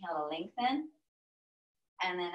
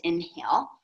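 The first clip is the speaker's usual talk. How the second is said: "inhale" said Texas-style, the vowel bent toward "in-hill"; Texas accent; 5 dB louder than usual. Shown as a demonstration. Teacher cueing the breath, in Texas accent. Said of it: "I'm gonna walk you through with my Texas accent".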